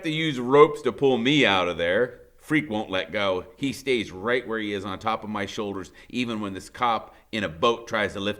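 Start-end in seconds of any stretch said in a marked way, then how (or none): none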